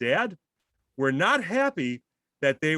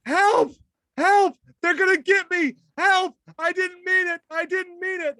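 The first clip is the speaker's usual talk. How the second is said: much higher, louder imitated screams for help; mock screaming; 5 dB louder than usual. Retelling his wife's screams as birds attack, shrill mock scream. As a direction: play the wife shrieking while fleeing. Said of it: "I hear the screaming".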